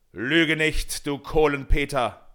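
loud, harsh thundering rebuke. A narrator's reading. Holländer Michel rebukes Peter, thundering voice. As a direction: roar it down at the liar. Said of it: "mit donnernder Stimme".

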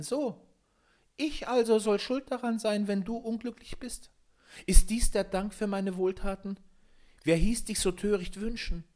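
high, small gnome voice, reproachful; indignant little forest spirit; little glass man. A narrator's reading. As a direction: scold in a tiny high-pitched voice. Little glass man scolds Peter.

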